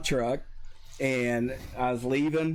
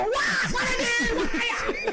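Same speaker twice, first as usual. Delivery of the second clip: panicked shout; slower than usual. Retold or imitated. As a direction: cry out in total disbelief. Reenacting his own panicked protest.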